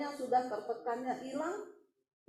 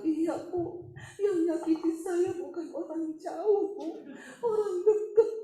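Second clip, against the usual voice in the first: higher-pitched, drawn-out mock confession; imitated tearful confider; 5 semitones higher, 3 dB louder, slower than usual. Woman confiding hurt to the pastor, imitated plaintively. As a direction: play the wounded woman, pitched up and slow.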